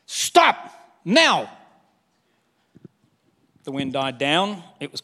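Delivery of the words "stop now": louder, higher, sharp order; forceful command. Pretend. Jesus commanding the wind and waves, forceful. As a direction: bark it at the storm. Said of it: "this is a forceful command".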